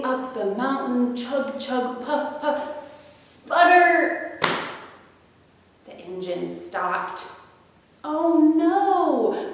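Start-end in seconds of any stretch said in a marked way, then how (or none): 1.1-4.4 chug and puff climbing higher to a loud sputter; sputtering engine. Acted. Storyteller voicing the train breaking down, rising to a loud sputter. Engine failing sound effects. 8.0-9.5 high, louder cry of alarm; a jolly train yelping in dismay. Acted. The stuck jolly train crying out to the toys, high and alarmed. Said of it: "cried the jolly train".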